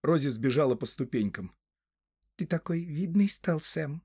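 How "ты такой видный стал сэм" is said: high female voice gone gentle; Rosie, softened. A narrator's reading. Rosie to departing sweetheart, suddenly softened tone. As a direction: drop the scolding and admire him tenderly. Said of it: "совсем другим голосом сказала она".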